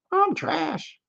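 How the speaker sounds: very high squeaky toy voice; Forky voice. Retold or imitated. Host voicing Forky saying his catchphrase. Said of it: "Forky's catchphrase voiced".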